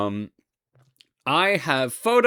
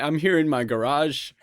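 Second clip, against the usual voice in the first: ordinary talk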